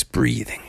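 long, slow, eerie breaths; heavy breathing. Acted. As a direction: breathe like a creature in a cave. Imitates breathing heard in cave.

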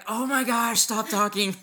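high loud flustered plea; mock panicked dad. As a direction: beg her to stop, flustered and loud. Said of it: "And I'm like".

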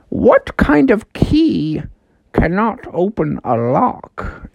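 slow, drawn-out riddle master, swooping high; talking house. Acted. Talking house puts a riddle to Froggy, slow and mysterious. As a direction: pose the riddle slowly and mysteriously. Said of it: "the house was like".

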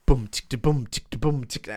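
mouth-drum beat, bass and tick; beatboxing. Retold or imitated. Student vocalising a drum part for his teacher, beatboxing. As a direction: imitate a drum kit with the mouth. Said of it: "Are you a fucking human beatbox".

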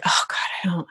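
breathy whispered groan; whispered dread. Retold or imitated. Dreading an unwanted task.

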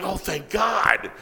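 high, gushing mock-dramatic thanks; overjoyed relief. Writer thanking his co-writer for the joke, overjoyed relief. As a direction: cry out your gratitude, starting slow.

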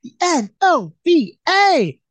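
high, loud, drawn-out letters; imitated daughter's inflection. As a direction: mimic a child's promo, stretching each letter up high. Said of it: "the way she said it with the inflection in her voice".

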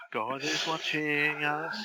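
slow, hushed mock-holy voice; mock solemn preacher. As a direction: intone it like a joking sermon.

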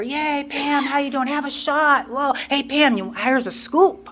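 high-pitched cheery barfly voices; rowdy bar greeting. Retold or imitated. Bar crowd greeting Pam when she opened the door, high mock cheer. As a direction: imitate drinking pals hailing a friend.

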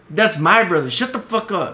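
loud, high, forceful snap; real anger. Real, not acted. Grieving man silencing a screaming aunt, furious outburst. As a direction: shout it down in fury. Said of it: "it makes me mad just thinking about it".

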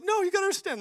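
high, loud pleading imitation; mock frantic insistence. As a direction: squeal it in hammed-up urgency. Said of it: "and they're like".

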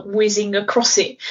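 ordinary talk

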